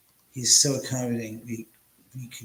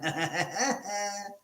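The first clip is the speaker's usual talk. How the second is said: high, quick giggling laugh; laughter; 8 semitones higher, 5 dB quieter than usual. Real, not acted. Teasing Ren about the gadget, high laughter. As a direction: laugh in a high, light run.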